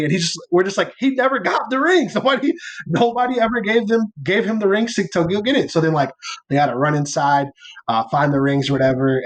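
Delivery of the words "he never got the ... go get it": higher-pitched, mock exasperated protest; retold protest. Wedding party protesting that he never got rings, mock exasperation. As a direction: protest it in a raised pitch, retelling it.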